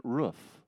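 ROOF with a short oo, rhyming with "hoof". Shown as a demonstration. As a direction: say ROOF with the vowel of "book". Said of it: "But it's hoof".

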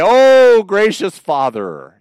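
"Oh" yelped high, then a slow, pompous "gracious Father"; a kid parroting lofty prayer talk; hammed-up churchy address; mock formal prayer. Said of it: "coming home from school and say".

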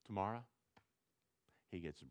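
ordinary delivery

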